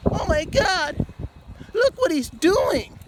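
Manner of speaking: high, slow scandalised gasp; mocking gasp. Mocking the critics who gasp at him, high and slow. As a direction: gasp it like a shocked onlooker.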